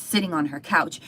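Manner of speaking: ordinary talk, nothing marked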